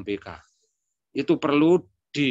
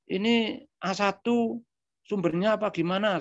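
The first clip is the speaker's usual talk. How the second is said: alarmed question; 7 semitones higher than usual. Retold or imitated. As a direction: ask it in startled worry. Hearing news the elder is ill.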